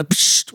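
hissed "pssht" like a ring-pull; imitated can opening. Doctor recalls arriving in Australia, imitated tinny hiss. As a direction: mimic a beer can being opened. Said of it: "And that's a tinny".

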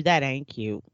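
dismissive husband. Retold or imitated. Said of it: "he told you".

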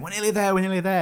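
high, eager repeating; excited. Retold or imitated. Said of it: "Chris sent us a message saying".

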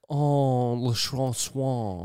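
slow, soft, hammed-up French; mock French. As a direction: drawl "Le Francois" like a pretentious tourist. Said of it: "They're like".

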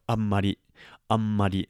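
"Amari" with a firm, stressed mm; "Amari" with the m held and emphasised; clear amari. Shown as a demonstration. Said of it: "getting that mm sound in there very emphatically".

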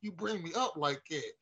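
slow, high aggrieved complaint; slow mock grievance. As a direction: draw it out, hurt and high. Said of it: "I was like".

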